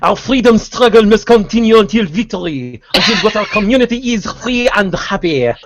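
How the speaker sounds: can barely hold the accent for giggling; laughing through it. Real, not acted. Cracking up while reading in a fake French accent. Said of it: "I'm gonna start laughing too much".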